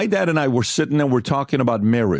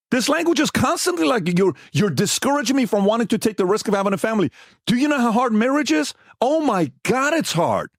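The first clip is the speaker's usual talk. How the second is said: higher, insistent protest; worked-up reenacted complaint; retold argument; 8 semitones higher than usual. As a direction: plead the point heatedly to dad. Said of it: "One of the biggest fights".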